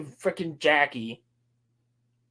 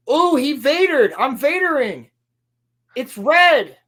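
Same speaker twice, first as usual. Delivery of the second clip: much higher, louder hammed-up excitement; mock excited squeal; 11 semitones higher, 6 dB louder, slower than usual. Acting out her reaction to the color red, hammed-up excitement. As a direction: draw out the high-pitched mock amazement.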